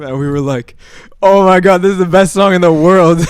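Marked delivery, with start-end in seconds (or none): 1.2-3.2 put-on loud, high burst of childlike excitement; excited raised-voice exclamation; thrilled. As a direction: gush loudly about a favourite song. Retold childhood delight at song.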